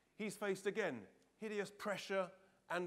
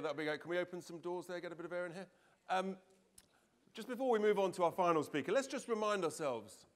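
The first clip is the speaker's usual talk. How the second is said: usual speaking voice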